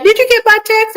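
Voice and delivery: high, loud eager asking; eager mock question. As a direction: chirp it eagerly. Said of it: "you'll be like".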